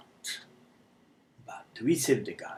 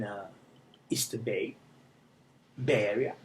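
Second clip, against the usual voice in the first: normal talking voice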